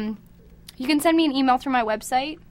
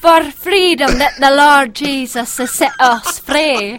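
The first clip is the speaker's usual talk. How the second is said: slow, louder Scottish brogue, preached; Scottish preacher shout; 8 dB louder, slower than usual. Shown as a demonstration. Guest keeps the brogue going, preaching the verse slowly and loudly. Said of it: "What is wrong with you?".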